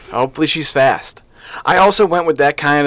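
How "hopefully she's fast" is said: usual speaking voice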